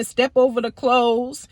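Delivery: usual speaking voice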